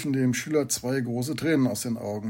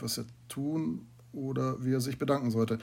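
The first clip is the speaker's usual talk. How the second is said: ordinary delivery, nothing marked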